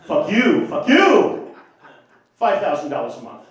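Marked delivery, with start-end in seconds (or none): 0.0-1.5 mock acting-class shout, rising high; hammed-up Method outburst; escalating repetition. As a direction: build each repeat into a louder yell. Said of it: "where they repeat".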